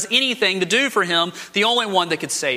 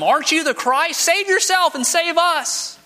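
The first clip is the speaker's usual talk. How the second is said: mocking sneer; 11 semitones higher than usual. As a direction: taunt Jesus from the cross in a high mocking voice. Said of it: "But he mocks him".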